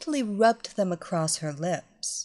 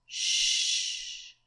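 sharp whispered hush; shushing; 4 dB quieter than usual. Acted. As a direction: hush her urgently. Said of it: "she commanded".

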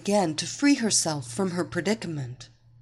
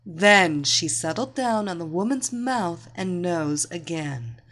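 ordinary delivery

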